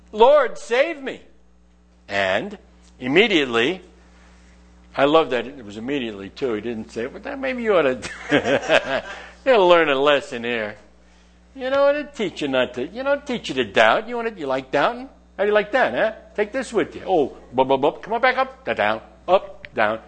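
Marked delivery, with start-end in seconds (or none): 0.1-1.2 plea. Shown as a demonstration. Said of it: "the solution then is". 7.1-20.0 mocking cruel God. Preacher mocks a cruel God toying with Peter, sneering taunt.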